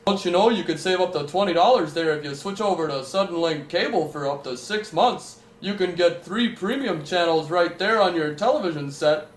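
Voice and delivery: hammed-up phone sales patter; salesman pitch. Cable company caller pitches a deal, mock salesman voice.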